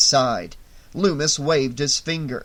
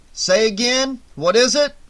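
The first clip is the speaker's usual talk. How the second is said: loud, higher repeat over static; radio call; 8 semitones higher, 6 dB louder than usual. A narrator's reading. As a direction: push it through the noise. Sheriff over crackling radio.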